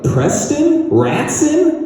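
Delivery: high, puzzled guesses at the name; mock clueless clerk squeaking each guess; confused pizza clerk. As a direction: pizza guy on the phone mishearing the name, high and baffled. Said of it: "they're like".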